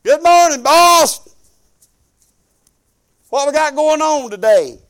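over-familiar worker greeting the boss; mock cheery boss greeting. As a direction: barge in casual and loud. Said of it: "to your boss".

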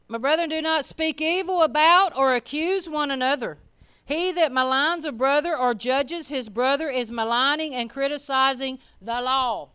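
scripture preached to the brethren. Acted. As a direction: preach the verse with weight. Rereads verse louder.

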